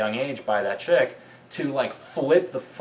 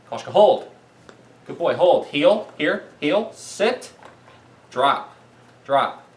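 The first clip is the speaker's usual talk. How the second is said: loud, higher commands to a dog; firm dog commands; 4 semitones higher, 6 dB louder, slower than usual. Real, not acted. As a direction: call each command out crisply to the dog.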